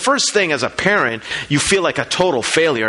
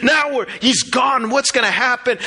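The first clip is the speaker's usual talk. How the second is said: higher-pitched retold panic, without real sobs; mock-distraught, pitched up; imitating panicked mother; 7 semitones higher than usual. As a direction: voice a mother's mounting alarm in retelling. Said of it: "Liliana is crying and she's thinking".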